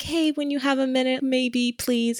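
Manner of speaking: high, timid, apologetic request; meek persistence. Acted. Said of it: "he's not being aggressive".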